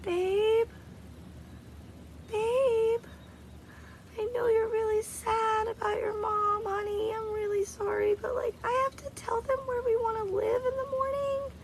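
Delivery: high, pleading babe voice, a touch of crying; hammed-up husband begging his wife; mock whiny husband. Husband coaxing his grieving wife, mock whiny pleading.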